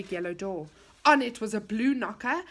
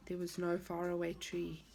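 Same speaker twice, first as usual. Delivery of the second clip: ordinary delivery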